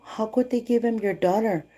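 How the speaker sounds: mildly outraged, incredulous asking; retold incredulity. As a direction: re-enact your disbelief, pitch lifted. Retelling her outraged question.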